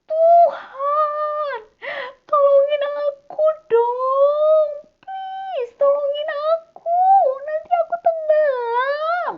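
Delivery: high, loud, tearful pleading; panicked cry for help. Acted. Peter sinking, crying high and loud to Jesus for help. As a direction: beg Jesus to save you as you sink. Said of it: "Baru dia teriak".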